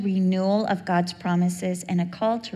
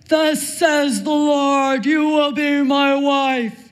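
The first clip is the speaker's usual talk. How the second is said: loud, slow, higher prophet voice; booming mock prophet; 5 semitones higher, 6 dB louder, slower than usual. Stalker proclaiming to Cheryl outside class, booming and slow. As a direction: proclaim it slowly like an oracle.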